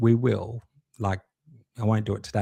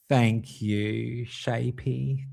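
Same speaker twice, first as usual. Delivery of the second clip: normal talking voice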